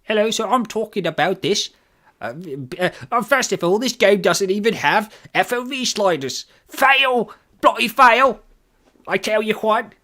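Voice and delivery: high, hammed-up British accent. Streamer ranting about FOV sliders in a mock British accent. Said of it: "exactly what every British person sounds like".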